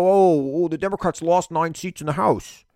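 high, whiny mock lament; mock dismay. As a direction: parody the hand-wringing critics. Mocking critics of the Democrats' result.

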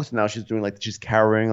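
ordinary talk

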